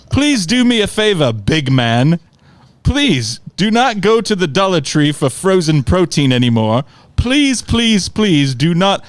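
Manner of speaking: put-on butchered London accent. Uncle begs Corey for a favor, butchered London accent. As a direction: beg pompously in a bad London accent. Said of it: "I'm sure I will butcher his accent".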